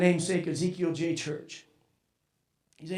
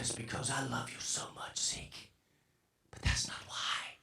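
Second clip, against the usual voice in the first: choked, whispered through tears; barely voiced, fighting back tears; tearful whisper; 11 semitones lower, 18 dB quieter than usual. Real, not acted. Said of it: "tears rolling off my face".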